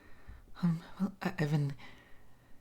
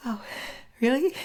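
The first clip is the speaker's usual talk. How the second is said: a sudden high burst of surprise; surprised; 9 semitones higher than usual. Acted. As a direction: caught off guard and delighted. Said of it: "I'm surprised".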